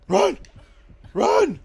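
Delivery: high, very loud shouts; high-pitched urgent shouting; urgent yell. Real, not acted. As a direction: scream at a teammate to flee. Urging teammate to flee.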